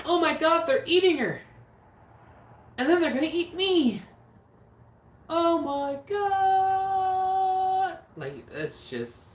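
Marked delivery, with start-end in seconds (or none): 0.1-8.0 high, loud panicked shriek, last Oh my God stretched out; hammed-up horror-movie scream; screaming movie victim. Quoting the Troll 2 victim for a viewer, shrieking in mock terror. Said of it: "he's just gonna be like".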